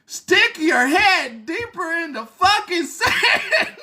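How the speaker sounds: slow, high mock shout; retelling her shouted jab; outdoor shout. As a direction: shout it like across a yard. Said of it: "says in an outdoor shout tone".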